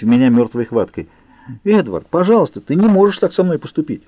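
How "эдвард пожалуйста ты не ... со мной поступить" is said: pleading. A narrator's reading. Bella begs Edward to turn back.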